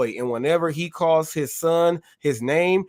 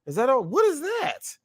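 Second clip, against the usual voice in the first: high mocking taunt, climbing higher; 7 semitones higher than usual. Reacting to the push video, taunting the pusher in a high mocking voice. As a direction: scoff at the weak push. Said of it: "I'm laughing like".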